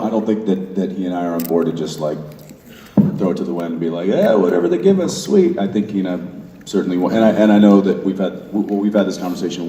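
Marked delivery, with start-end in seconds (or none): 4.1-5.6 high, breezy mock agreement; mock easygoing enthusiasm. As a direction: play a happy pushover, pitched way up. Mimicking an easygoing, accepting attitude.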